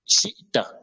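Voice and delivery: shita broken by a glottal stop before "ta"; stopped hamza. Shown as a demonstration. Teacher demonstrating the hamza, sound stopped mid-word. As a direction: cut the sound dead after shi, then ta. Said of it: "arrêt du son sur le hamza".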